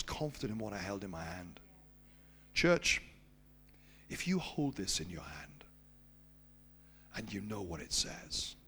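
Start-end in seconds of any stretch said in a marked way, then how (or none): 2.5-8.5 sermon appeal. Acted. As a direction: drive the point home to the church. Applies story to church.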